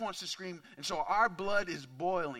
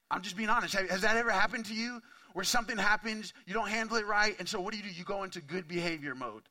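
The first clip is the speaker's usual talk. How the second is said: ordinary talk, nothing marked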